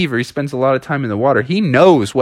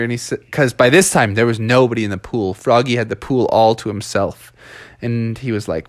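ordinary delivery, nothing marked

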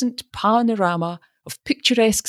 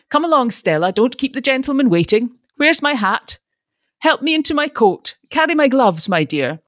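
fussy father; 8 semitones higher, 4 dB louder than usual. A narrator's reading. Father bustling daughter into car.

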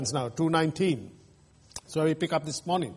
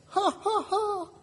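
high jeering ha ha ha, much higher; a taunting cackle at the builders; mocking laughter; 13 semitones higher, 5 dB quieter, slower than usual. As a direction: laugh scornfully like the mockers. Preacher acts out enemies jeering.

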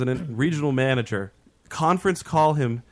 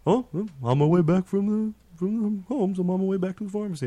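higher-pitched, airy mock-innocent voice; nonchalant driver; 6 semitones higher than usual. Driver tells his boss where he is, mock nonchalant. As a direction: imitate a driver with no care at all. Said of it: "he just answers so nonchalantly".

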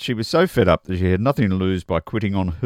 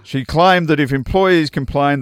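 ordinary talk

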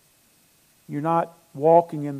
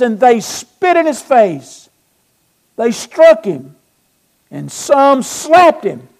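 loud, high preaching at full force; raised, driving sermon voice; fervent preaching; 5 semitones higher, 12 dB louder than usual. Acted. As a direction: build the fervor, hitting each blow hard. Preaching builds as mob takes over.

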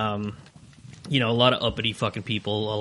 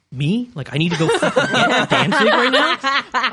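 retold disbelief; 9 semitones higher, 3 dB louder than usual. Retold reaction to unwanted errand.

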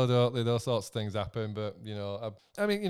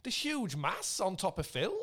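normal talking voice

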